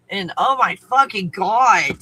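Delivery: loud, exasperated swearing; frustrated outburst. Real, not acted. Vlogger to viewers, loud and exasperated. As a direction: vent it loudly.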